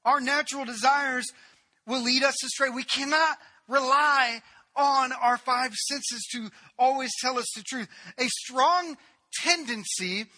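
fiery pulpit crescendo; full-force preaching. Acted. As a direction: preach it hard to the congregation. Preacher's closing surge.

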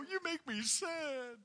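high, drawn-out mock lament; mock sad. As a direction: imitate someone pouting in a high, slow voice. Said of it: "though God does not go".

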